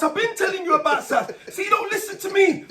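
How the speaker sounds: high, loud scolding voice; high mock scolding of a little brother; brother's retort. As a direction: mimic a brother who claims he was ignored. Brother's voice in retold exchange.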